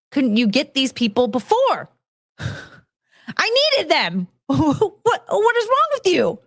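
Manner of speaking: high-pitched, exasperated mock outburst; upset outburst. Ex-employee confronting manager over three replacements, hammed-up upset outburst. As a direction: climb into an indignant squeal, half laughing. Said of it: "So I was very upset".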